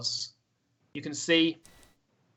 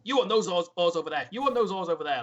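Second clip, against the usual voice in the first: higher, firm crew-boss commands; ordering people about; 4 semitones higher, 4 dB louder than usual. Acted. Sailor assigning rowers to oars, ordering. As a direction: give out stations like a busy boat boss. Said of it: "starts ordering people".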